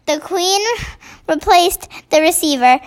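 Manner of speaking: ordinary delivery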